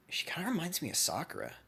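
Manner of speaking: secret whisper, barely voiced; hushed private aside; whispered thought. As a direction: breathe it to yourself so nobody hears. Closet pervert hiding his thoughts.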